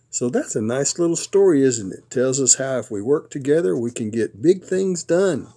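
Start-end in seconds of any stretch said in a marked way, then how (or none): none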